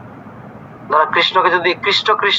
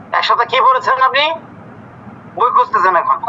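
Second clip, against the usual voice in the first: exasperated; 6 semitones higher than usual. Real, not acted. Teacher scolds student opening book.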